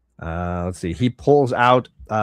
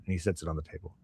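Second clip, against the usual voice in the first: ordinary talk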